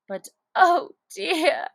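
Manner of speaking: crying out, tearful; burst of tears. Acted. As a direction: cry it as the tears come. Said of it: "cried Alice with a sudden burst of tears".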